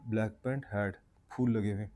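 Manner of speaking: ordinary talk, nothing marked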